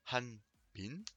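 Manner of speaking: "Hanbin" with a slightly breathed h; Korean pronunciation. Shown as a demonstration. Teacher showing the name to repeat. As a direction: model "Hanbin" for learners. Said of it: "prononcez bien le H".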